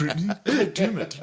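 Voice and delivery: high, put-on scolding voice; mock scolding. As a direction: ham up his angry girlfriend-scold. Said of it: "mimicking friend's scolding".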